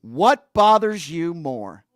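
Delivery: loud, drawn-out, emphatic demand; yelling. Real, not acted. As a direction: punch out each word slowly and loudly. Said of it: "You, you yelling at me".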